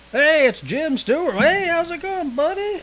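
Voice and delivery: excited, sing-out greeting well above normal pitch; hearty greeting. Retold or imitated. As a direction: hail a buddy across the lobby. Acting out his lobby greeting to Jim.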